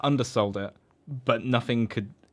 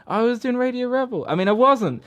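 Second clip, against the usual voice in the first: flustered denial; 11 semitones higher, 4 dB louder than usual. Retold or imitated. Character flustered lying.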